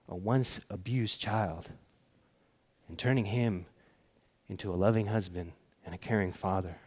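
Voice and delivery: low, soft, solemn sermon with long pauses; hushed preaching. Real, not acted. Preacher lists miracles of faith for the congregation, hushed and solemn. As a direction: let each phrase settle, quietly and deliberately.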